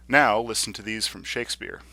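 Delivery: usual speaking voice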